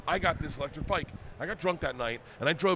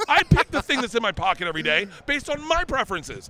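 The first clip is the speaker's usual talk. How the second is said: loud, higher, indignant telling-off of his wife; retold angry rant; 7 semitones higher, 6 dB louder than usual. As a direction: make it a righteous rant about the wallet.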